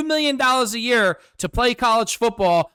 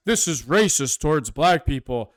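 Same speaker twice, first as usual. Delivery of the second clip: lower, sneering mock voice of a whiny liberal; mocking Democrat; 5 semitones lower than usual. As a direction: parody the outraged Democrat, lower.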